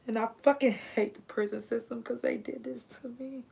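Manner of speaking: whispered through held-back tears, real; bitter whisper. Real, not acted. Telling viewers what prison did to her, whispered. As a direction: hush it out, spent and bitter. Bitter aside after a sigh.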